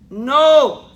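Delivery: loud, high emphatic no. Real, not acted. Lecturer to nursing students, a loud emphatic no. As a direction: shoot down the wrong idea hard. Emphatic answer to class question.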